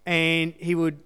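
ordinary talk

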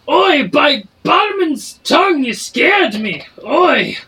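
high, loud barking tavern keeper; a barkeep shouting from behind the bar; dwarf barkeep. Acted. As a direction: bark orders at the kitchen staff. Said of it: "His voice does not match his description".

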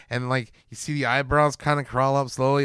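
normal talking voice

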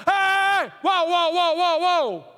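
high, loud "hey, whoa"; shouted interruption. Acted. Preacher showing how to stop gossip, loud halting shout. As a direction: break in hard to shut it down.

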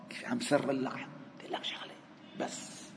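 a secretive whisper close to the ear. Shown as a demonstration. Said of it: "كأخي السرار". (همس كأنه يسرّ بسرّ)

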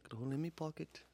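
soft mock Liverpool accent; mumble-free, quiet Liverpool impression to yourself. Trailing the Beatles joke, soft fake Liverpool accent. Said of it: "We are from Liverpool".

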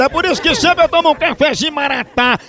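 upbeat radio ad pitch for the coffee; coffee ad read. Acted. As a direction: sell the coffee like a live radio spot. Host reads coffee ad. (leitura de comercial animada)

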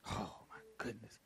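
hushed, breath-only aside; awed whisper. Real, not acted. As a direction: whisper it to yourself, overcome. Overcome aside after preaching.

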